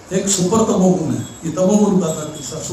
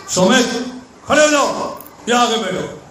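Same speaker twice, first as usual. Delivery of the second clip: higher and sharper order to come sit; forceful command; 6 semitones higher than usual. Shown as a demonstration. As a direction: boss him over with force. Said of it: "दूसरा जोर देके बोला". (जोर देकर आदेश)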